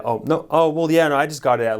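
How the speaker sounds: flustered. Retold or imitated. Young narrator caught off guard by a girl, flustered. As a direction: scramble to defend yourself. Flustered reply to necklace insult.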